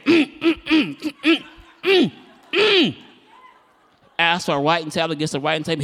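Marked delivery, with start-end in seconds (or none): 0.1-3.0 high, urgent closed-mouth "mm" noises; hammed-up muffled humming; mute man's grunts. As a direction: imitate trying to talk without words. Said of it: "So he just been saying".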